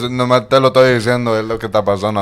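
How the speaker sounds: loud mock protest in Spanish; imitated president. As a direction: play the blunt president, hammed up and loud. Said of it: "He was like".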